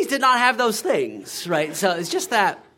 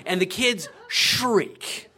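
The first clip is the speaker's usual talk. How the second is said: ordinary talk, nothing marked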